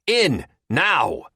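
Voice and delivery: high, loud order; yelled command. A narrator's reading. Terry ordering the dog into the cart, yelling. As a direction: order it in with a yell. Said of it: "Terry yells".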